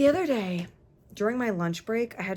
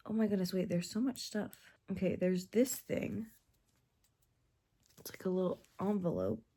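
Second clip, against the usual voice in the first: ordinary talk, nothing marked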